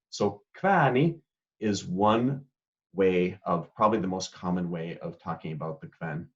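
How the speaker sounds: ordinary talk, nothing marked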